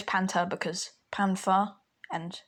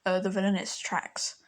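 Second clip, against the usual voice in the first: ordinary talk, nothing marked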